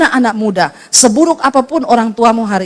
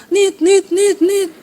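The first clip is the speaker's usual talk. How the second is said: dial tone beeps; 8 semitones higher, much slower than usual. Retold or imitated. Phone line cut off.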